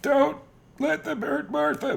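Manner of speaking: very high, slow put-on voice; movie quote done as a high mock plea; mock pleading. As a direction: wail it like a dying hero. Quoting movie line, put-on voice.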